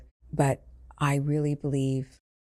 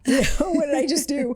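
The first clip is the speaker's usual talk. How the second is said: high, louder laughing disbelief; laughing embarrassment; 10 semitones higher, 6 dB louder than usual. Retold or imitated. Medium embarrassed after shouting stop, laughing high. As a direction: laugh at your own outburst.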